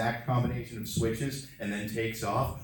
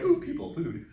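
hungry Ewok voice. Retold or imitated. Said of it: "they're like".

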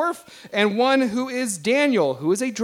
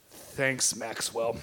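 very quiet, low, hurt thanks; deflated thanks; 10 semitones lower, 11 dB quieter, much slower than usual. Acted. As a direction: say it small, as if crushed. Repeats thanks after being called weak.

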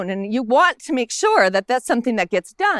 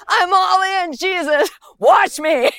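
higher, louder, all-in plea; eager Peter; 6 semitones higher, 4 dB louder, slower than usual. Retold or imitated. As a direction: throw yourself in, begging to be washed.

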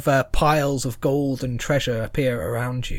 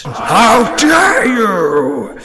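slow, loud, higher booming rage; furious roar; 7 semitones higher, 10 dB louder, much slower than usual. Acted. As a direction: bellow it like a wrathful god. Fire entity rages.

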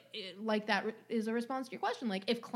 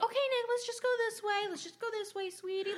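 high, sweet coaxing voice; gently steer a sleepwalker, pitched way up; imitated mother soothing a child; coaxing mom; 11 semitones higher than usual. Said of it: "trying to keep him asleep".

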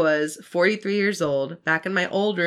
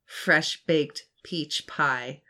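ordinary talk, nothing marked

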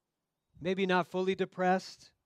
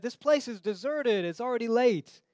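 impatient disciples; 6 semitones higher, 5 dB louder than usual. Acted. Preacher voicing impatient disciples.

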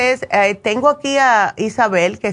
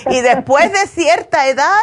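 laughing; 5 semitones higher than usual. Real, not acted. Laughs after teasing caller.

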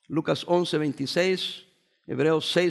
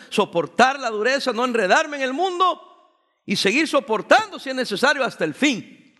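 rising, louder preaching; preaching at full force; 4 semitones higher, 4 dB louder than usual. Acted. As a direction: drive the message home from the pulpit. Preaching builds up.

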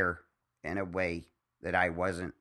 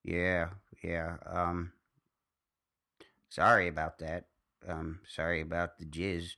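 ice in mouth. Real, not acted. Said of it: "I've got ice in my mouth".

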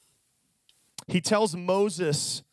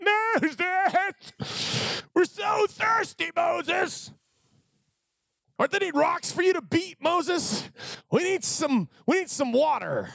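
whiny thirsty Israelites; 11 semitones higher, slower than usual. Retold or imitated. Thirsty crowd pestering Moses for more water, high whine. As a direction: nag Moses for water like spoiled kids.

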